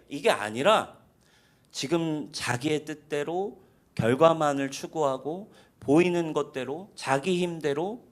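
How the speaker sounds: ordinary talk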